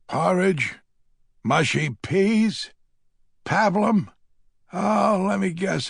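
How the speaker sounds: sarcastic. A narrator's reading. Sarcastic menu guesses.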